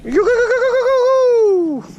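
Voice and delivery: very slow, high and loud; drawn-out excited. Real, not acted. Mate on the boat answers, drawn-out excited cry. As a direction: stretch every word out, pumped up.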